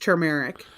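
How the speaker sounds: turmeric said ter-MARE-ick; turmeric opening with a clear "tur"; R pronounced. Shown as a demonstration. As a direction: sound the first R in turmeric. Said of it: "with an R".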